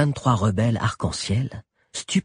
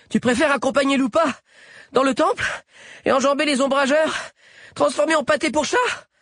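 very high, raised character voice; high challenging question. A narrator's reading. Sabi to the partisans while running, high challenge. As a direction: throw the question out in high bursts.

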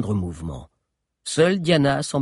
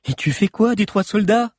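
worried minister; 9 semitones higher, 4 dB louder than usual. A narrator's reading. Solario's worried voice.

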